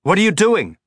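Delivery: high, loud alarmed shout. Acted. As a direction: yell it in alarm. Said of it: "Holden shouted".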